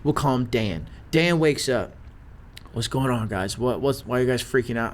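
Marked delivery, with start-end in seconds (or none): 2.7-4.9 low, softer, groggy-dude question; just-woken dude. Retold or imitated. Dan waking up to panicking guys, sleepy confusion. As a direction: play him low-key, just waking up. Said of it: "the main dude wakes up".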